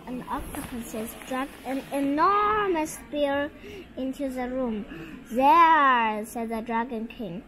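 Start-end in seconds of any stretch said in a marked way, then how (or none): 5.3-6.2 a loud, proud "There,"; proud announcement. Acted. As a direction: present the spear loudly and proudly with "There,". Dragon King presents the spear again.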